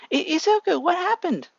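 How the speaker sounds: very high alarmed mother's voice; worried mother. A narrator's reading. Mother bursting into her son's room, alarmed. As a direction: rush in alarmed.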